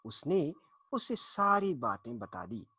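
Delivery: ordinary delivery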